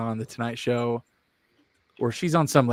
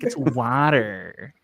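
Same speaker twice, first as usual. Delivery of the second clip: very drawn-out American "water"; imitated American accent, stretching "water" right out; American-accented retort; much slower than usual. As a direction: insist on "water" with a flat "wah" and a hard final r. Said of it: "dad doesn't".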